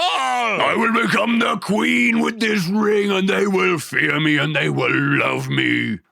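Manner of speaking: slow, booming mock elf-queen proclamation; Galadriel impression. Teasing her cohost with the elf queen's menacing vow. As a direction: drag it out like a power-mad queen. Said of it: "was she Galadriel".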